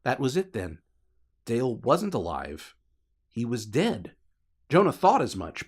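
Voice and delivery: usual speaking voice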